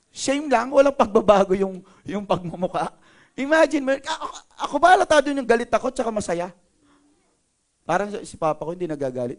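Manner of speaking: normal talking voice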